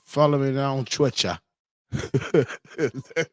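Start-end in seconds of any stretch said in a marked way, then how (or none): 0.0-1.4 put-on British accent, pitched up. Streamer mimicking how the UK says Twitter, British accent. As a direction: imitate a British speaker, saying Twitter as "Twitchuh". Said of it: "is that how you say Twitter".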